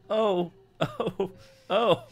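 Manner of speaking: high moaning oh oh oh; overacted cold-water moan; hammed-up moan. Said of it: "made me moan out loud".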